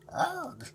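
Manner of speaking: high, sharp animal grunt; baboon grunt. Acted. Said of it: "Kafoo grunted at me".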